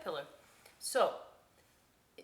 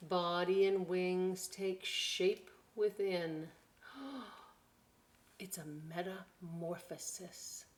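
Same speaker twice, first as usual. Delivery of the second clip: put-on slow, storybook read-aloud for children; slow, hushed-to-bright storybook narration; story reading; slower than usual. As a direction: read it like a wonder-filled picture book. Reading picture book aloud.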